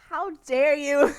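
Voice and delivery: loud, slow, dramatic "how dare you"; hammed-up indignation at the commenter; mock outrage. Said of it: "I was like".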